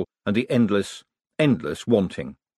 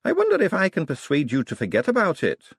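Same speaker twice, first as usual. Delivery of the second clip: usual speaking voice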